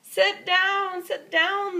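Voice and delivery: high, loud urgent calls; firemen calling out. Acted. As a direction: shout a warning to sit down. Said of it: "the firemen are saying".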